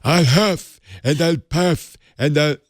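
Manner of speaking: slow, through puffed-out cheeks. Pretend. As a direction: puff your cheeks, huff it out slowly. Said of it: "Blow up your cheeks".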